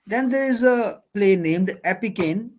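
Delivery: usual speaking voice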